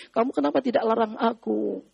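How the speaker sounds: ordinary delivery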